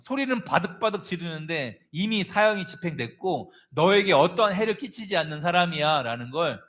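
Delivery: ordinary delivery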